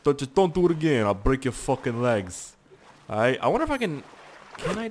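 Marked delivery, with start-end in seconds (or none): 0.0-2.5 loud, fast New Yorker threat; mock New York tough talk; New Yorker accent. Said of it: "like, New Yorker on my ass".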